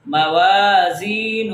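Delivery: موازینہ slow, waa, zee and final hoo held; three long vowels. Shown as a demonstration. Teacher drills madd letters, موازینہ drawn out. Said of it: "ان تین کو لمبا کریں گے".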